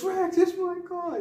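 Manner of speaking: very high, loud, slow mock wail; outraged wail. Acting Murtaugh over his scratched car, outraged. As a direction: screech it in comic outrage.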